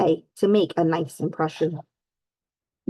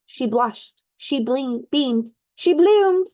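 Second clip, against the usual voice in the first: joyful climax; 6 semitones higher, slower than usual. A narrator's reading. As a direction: let the word bloom with delight. Narrator's climactic joyful line.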